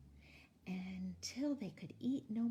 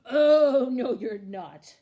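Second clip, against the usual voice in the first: very loud, drawn-out opening roar; troll roar; 3 semitones higher, 17 dB louder, slower than usual. A narrator's reading. Troll refusing to let the goat pass, roaring. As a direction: roar a refusal, huge at the start. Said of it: "roared the troll".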